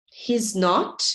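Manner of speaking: ordinary delivery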